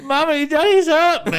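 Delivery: put-on high, loud little-kid tattling; hammed-up child's complaint; mock wailing child. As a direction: wail to mummy like a hurt child. Child telling tales to mum.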